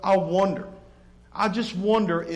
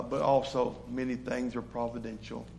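normal talking voice

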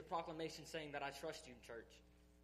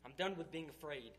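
building, louder fervent preaching; full-force preaching; 4 dB louder than usual. Acted. Speaker to his church, declaring he is done with fear, in building preaching. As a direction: pour it out like a revival sermon, climbing.

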